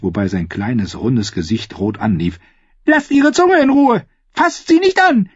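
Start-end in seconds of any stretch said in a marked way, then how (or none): 2.8-5.3 put-on high shrill shout of a boy, much louder; boy screaming. A narrator's reading. Boy king screaming at his mother in outrage. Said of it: "schrie Tommen plötzlich".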